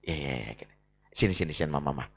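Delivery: calm the husband down gently; wife hushing her tired husband softly; soothing wife. Retold or imitated. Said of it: "Istri ... akan bilang gini".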